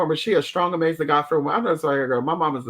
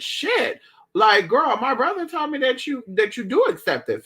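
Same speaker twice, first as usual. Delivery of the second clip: high, bright mock girl voice; girlish quoted voice; 8 semitones higher than usual. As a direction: quote her up high and sassy.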